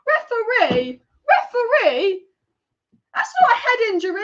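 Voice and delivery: high, loud outraged appeal to the referee; furious shout at the ref. Real, not acted. Fan at a watch-along yelling at the referee, outraged. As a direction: yell at the ref in disbelief.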